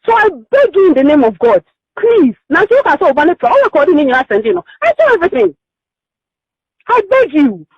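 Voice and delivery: usual speaking voice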